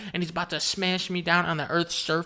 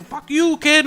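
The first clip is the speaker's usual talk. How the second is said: angry yell; 9 semitones higher, 8 dB louder, slower than usual. Acted. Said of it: "And Madden's like".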